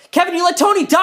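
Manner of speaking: high, loud, horrified accusation; accusing shout. Acted. Accusing Kevin of letting Tony die, horrified yell. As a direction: shout it in alarm, pitch way up.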